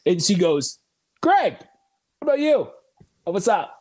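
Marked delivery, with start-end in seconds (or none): 1.2-3.7 high, bright-pitched imitation of a teacher calling on him; mock teacher, much higher than his own voice; playing the teacher picking him out. Teacher voiced in retold story.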